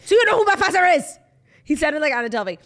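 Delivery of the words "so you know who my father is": high, loud outraged rich-kid impression; hammed-up club-door tantrum; mock meltdown. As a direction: imitate an entitled man's loud breakdown. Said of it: "having a fucking mental breakdown".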